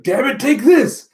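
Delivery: high, loud attack shout; battle cry. Acted. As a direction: hurl it as you strike. Character attacking in fight.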